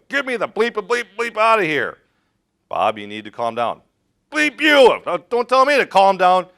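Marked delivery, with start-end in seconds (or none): 0.1-2.0 high, loud furious yell; cornered, swearing rage; angry patient. Acted. Role-played panicking patient swears. 4.3-6.5 very high, very loud enraged yell; shrieking patient who won't be calmed; furious scream. Acted. Role-played patient screams back.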